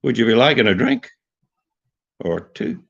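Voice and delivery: a light Irish lilt, starting low and rising; lightly voiced Irish accent, rising tune. Shown as a demonstration. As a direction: offer a drink in a mild mock Irish lilt. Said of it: "They sort of start low and go high".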